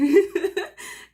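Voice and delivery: loud high nervous giggle; jittery burst of laughter. Real, not acted. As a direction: laugh from sheer nerves. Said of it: "I'm laughing 'cause I'm so nervous".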